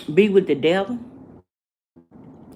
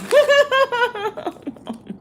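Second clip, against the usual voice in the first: sudden high-pitched laugh; amused high cackle. Real, not acted. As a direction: burst into a squeaky laugh. Laughs at second distraction.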